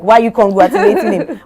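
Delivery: loud, high incredulous question; puzzled onlookers. Retold or imitated. Onlookers baffled she congratulates Frank, loud disbelief. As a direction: imitate people baffled by the congratulations. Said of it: "most people will be like".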